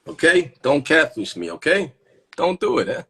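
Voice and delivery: high, loud mock scolding; insistent pleading. Pleading with women not to catfish.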